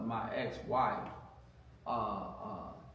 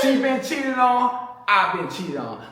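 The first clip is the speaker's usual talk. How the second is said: higher and louder, animated quote; excited retelling; 11 semitones higher, 10 dB louder than usual. Retold or imitated. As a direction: replay the pickup line with gusto, laughing at the end. Retelling his line to her.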